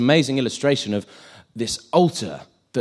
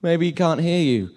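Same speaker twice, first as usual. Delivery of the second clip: higher-pitched teasing suggestion; mocking taunt; 7 semitones higher, 4 dB louder than usual. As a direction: poke fun with pretend helpfulness. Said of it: "made fun of them".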